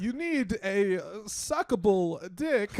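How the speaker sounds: high, slow mock Walken; Walken impression. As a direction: drag it out like Walken. Joining the Walken bit.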